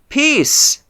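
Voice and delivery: a runner crying out to the king; yelled greeting. Acted. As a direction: call it out as he runs up. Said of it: "Ahimaaz yelled and said to the king".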